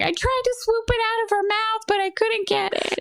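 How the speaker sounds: put-on high-pitched kid excuse; explaining kid. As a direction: plead your case like an eager young girl.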